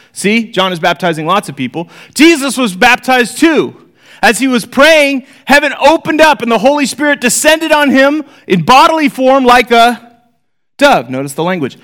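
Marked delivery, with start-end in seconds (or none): 2.1-11.1 scripture reading. Acted. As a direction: read it up high, stretch the close. Reading Luke aloud.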